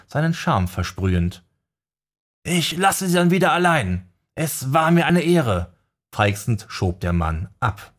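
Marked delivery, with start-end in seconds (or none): 2.4-5.7 Fan. Acted. Fan verabschiedet sich.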